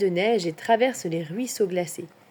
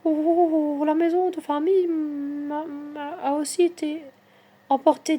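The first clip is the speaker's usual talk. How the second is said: voix aiguë et inquiète de personnage; tremblante; 7 semitones higher than usual. A narrator's reading. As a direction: raconter le malheur en tremblant, plus aigu. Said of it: "dit-elle, en tremblant". (d'une voix tremblante)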